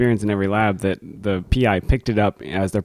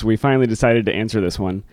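ordinary talk, nothing marked

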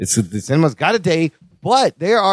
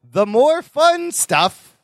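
sarcastic title read; 10 semitones higher, much slower than usual. Acted. Mocking the re-release title.

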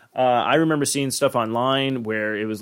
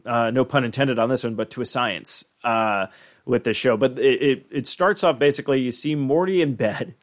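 normal talking voice

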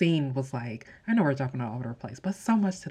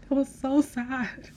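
high and slow, with a laugh in it; a real laughing sigh over a sad scene; laughing sadly; 10 semitones higher, slower than usual. Real, not acted. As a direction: lament it while half laughing.

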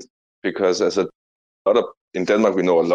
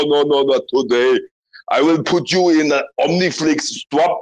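hammed-up Austrian action-star impersonation; thick mock-Austrian accent with lilting, boastful delivery; Arnold; 2 semitones higher than usual. Comic asked to make comedian laugh, hammed-up Arnold impersonation. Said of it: "Arnold impersonation to make the comedian laugh".

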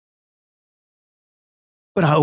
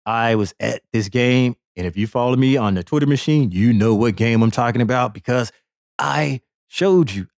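ordinary talk, nothing marked

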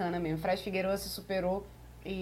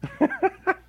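high laugh; 10 semitones higher, 5 dB louder than usual. Real, not acted. Laughs at the ET joke.

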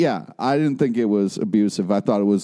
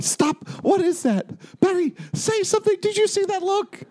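retold yelling. Viewer recalling yelling at Barry over that look, mock yelling. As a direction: replay your frantic yelling at the screen, without full volume. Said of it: "I was yelling at".

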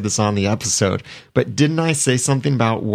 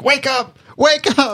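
high-pitched imitation of frantic yelling; mock movie yell; 3 dB louder than usual. Speaker mimics Hollywood CPR scenes, mock yell. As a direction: yell it like a Hollywood rescuer, hammed up. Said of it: "They always yell. They're like".

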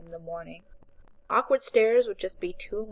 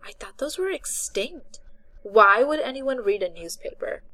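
put-on scornful young woman; 4 semitones higher, 6 dB louder than usual. A narrator's reading. Voices Becca.

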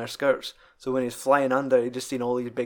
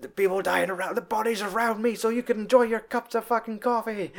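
high mock veteran rant; imitating Walter's Nam rant; 9 semitones higher than usual. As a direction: rant like a bitter vet. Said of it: "he always talks about".

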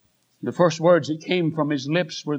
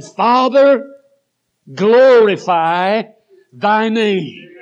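ringing proclamation; 3 semitones higher, 6 dB louder, much slower than usual. Acted. Preacher crying out Jesus's prayer to his Father, loud and slow. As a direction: proclaim it to the rafters.

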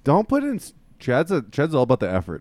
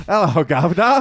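louder, higher mock cockney hello; hammed-up British greeting; 6 semitones higher, 6 dB louder than usual. Guy pushing his British pickup line, hammed-up British accent. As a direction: belt out a cheesy fake British greeting. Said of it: "It's a classic".

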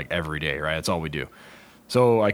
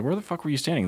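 incredulous demand; 9 semitones higher, faster than usual. Retold or imitated. Reenacts questioning bitten handler.

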